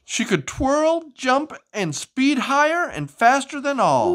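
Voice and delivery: lively, sing-song storybook reading; animated picture-book narration; reading to children. Acted. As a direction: read it like bedtime story time for a child.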